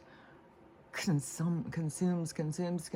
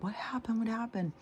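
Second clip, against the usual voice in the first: much higher, worried mock mom; alarmed mom; 9 semitones higher than usual. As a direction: play the startled mother asking.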